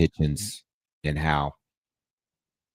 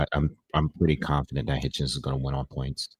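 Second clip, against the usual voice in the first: ordinary talk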